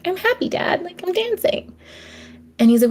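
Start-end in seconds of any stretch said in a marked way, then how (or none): 0.0-1.7 lightly voiced happy chick, higher; a hint of a cheerful baby penguin answering his dad; young penguin telling his dad he is happy, lightly voiced and higher; little penguin.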